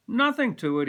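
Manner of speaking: a hint of a breezy barbershop regular; reassuring man. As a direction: shrug it off for the boy. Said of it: "he says".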